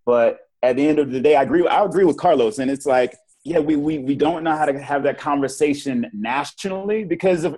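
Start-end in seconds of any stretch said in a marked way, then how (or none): none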